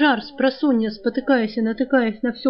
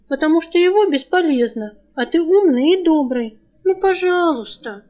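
coaxing plea; 5 semitones higher, 4 dB louder than usual. Acted. Girl coaxing friend with flattery.

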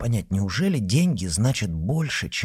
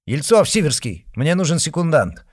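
loud, high call across the room; shouted; 6 semitones higher, 6 dB louder than usual. Acted. Murin calling his friends across the room, shouting. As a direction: call out for a second. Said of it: "крикнул".

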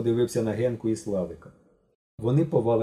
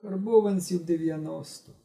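offhand; 7 semitones higher than usual. A narrator's reading. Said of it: "недбало відповів Коровін".

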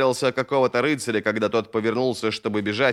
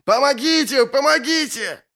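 anguished cry for help; 14 semitones higher, 3 dB louder than usual. Acted. Victim begs again behind the door, anguished high cries. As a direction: call for rescue in a straining high voice. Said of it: "раздавался надрывный голос".

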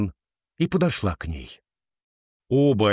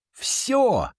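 excited shriek; 13 semitones higher than usual. Acted. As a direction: squeal it out, thrilled. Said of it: "взвизгнула Шивон". (восторженный визг)

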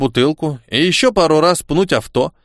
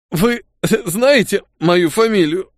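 through tears; 5 semitones higher, slower than usual. A narrator's reading. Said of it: "сквозь слёзы начал я".

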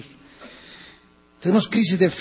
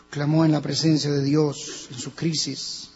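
ordinary delivery

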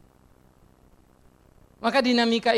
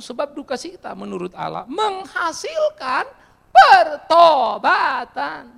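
slow, louder preaching read; fervent scripture reading; 4 semitones higher, 8 dB louder, slower than usual. Acted. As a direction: build to a climax on pertobatan. (membaca ayat dengan khotbah berapi-api, pelan dan keras)

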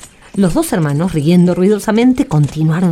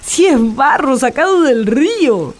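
disgusted brother; 6 semitones higher, slower than usual. Acted.

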